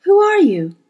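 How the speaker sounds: put-on high, loud boy's question; alarmed boy. A narrator's reading. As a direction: demand it as a scared boy.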